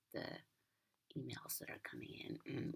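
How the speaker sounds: ordinary delivery, nothing marked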